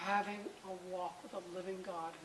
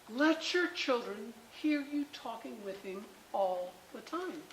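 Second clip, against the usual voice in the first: normal talking voice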